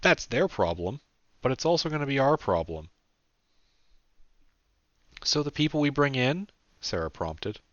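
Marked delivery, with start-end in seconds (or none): 5.2-6.5 put-on higher young woman's voice; Sarah's voice. A narrator's reading. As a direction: pitch it up for Sarah.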